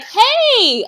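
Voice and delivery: high, easygoing greeting; a cheery host who is not bothered; breezy hey. Retold or imitated. Voicing the casual late host.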